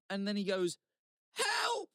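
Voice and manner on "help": much higher, drawn-out mimicked wail; intense cry for help. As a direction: imitate a trapped man crying out.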